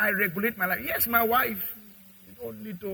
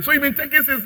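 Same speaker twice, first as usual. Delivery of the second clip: angry parent; 7 semitones higher, 7 dB louder than usual. Retold or imitated. Parent confronting thieving boy.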